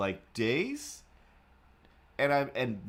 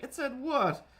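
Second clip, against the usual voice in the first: squeaky cartoon voice, much higher; poop character voice; 14 semitones higher than usual. Poop asking the banana a joke, high cartoon voice. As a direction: play a tiny silly poop, high pitched. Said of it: "the poop is like".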